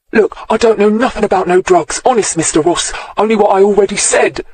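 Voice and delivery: a cowering informer pleading; snivelling. A narrator's reading. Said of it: "he snivelled".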